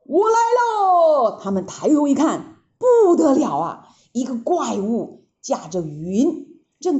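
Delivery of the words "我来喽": high, drawn-out shout from the sky; big shout. A narrator's reading. 悟空从云上高喊回来了. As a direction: call out loudly as you swoop down. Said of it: "有人大喊呐".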